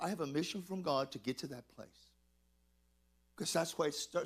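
ordinary talk, nothing marked

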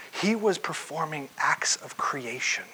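whispered slowly for weight; hushed whisper. Real, not acted. Preacher whispers the revelation to the congregation, hushed. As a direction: breathe the revelation, slow.

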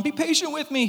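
high, pleading plea for mercy; begging servant. Acted. Kneeling servant begs his master for patience, high and pleading. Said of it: "he begged".

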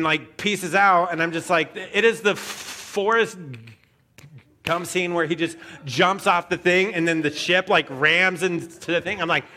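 ordinary talk, nothing marked

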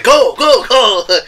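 high, loud urging yell; urgent shout. Retold or imitated. Retelling the movie's big moment to co-hosts, urgent shouting. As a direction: shout it out like a rallying cry. Retold character urging the others on.

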